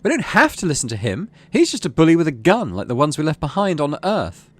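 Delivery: crying out. Acted. Mars rushes the stage and cries out to the crowd. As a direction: plead urgently with the crowd. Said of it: "she cries".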